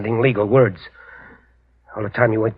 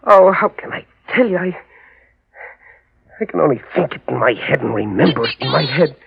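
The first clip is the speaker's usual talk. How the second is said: usual speaking voice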